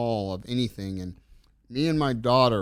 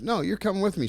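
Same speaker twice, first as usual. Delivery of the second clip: pitched-up, insistent retold order; firm insistence; 9 semitones higher, faster than usual. Dad overruling his reluctant daughter in the car, insistent. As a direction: act out a parent refusing to budge.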